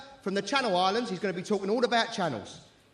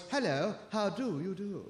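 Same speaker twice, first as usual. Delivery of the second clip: softer, mock-posh English greeting; poshest voice; 3 semitones lower, 7 dB quieter than usual. Shown as a demonstration. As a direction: put on a very posh English accent. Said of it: "say in their poshest voice".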